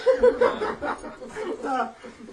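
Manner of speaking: high burst of laughter; laughing. Real, not acted. Laughing at the story.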